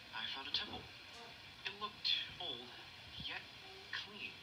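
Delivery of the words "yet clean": plain reading voice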